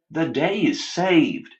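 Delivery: yelling. Acted. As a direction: shout it to the whole parking lot. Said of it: "A man in a trucker hat yelled".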